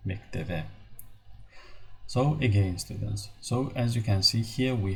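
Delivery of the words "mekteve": mekteve with a V, said softer; mekteve, V in the last syllable; B read as V. Shown as a demonstration. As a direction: softly repeat mekteve with V for the written B. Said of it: "we say mekteve".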